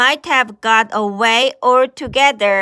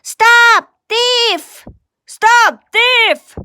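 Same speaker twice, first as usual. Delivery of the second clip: high, loud shout of stop thief; calling out; 9 semitones higher, 6 dB louder, slower than usual. Acted. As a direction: yell after the fleeing rabbit. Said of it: "calling out".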